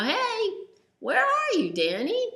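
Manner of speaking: high, loud call across a field; yelled call. Acted. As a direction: shout out to find a hidden friend. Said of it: "he yelled out".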